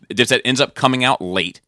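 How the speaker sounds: normal talking voice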